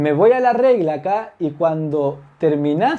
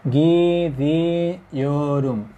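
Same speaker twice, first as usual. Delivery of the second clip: slowly drawn out; much slower than usual. Shown as a demonstration. Teacher spelling out gidiorum slowly for the class. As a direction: stretch gidiorum very slowly, syllable by syllable. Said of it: "¿Se fijan?".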